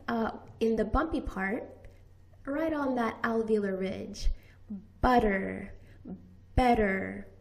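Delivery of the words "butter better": butter and better with a quick flap; better said with a fast tongue tap; flapped t. Shown as a demonstration. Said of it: "we use the flap".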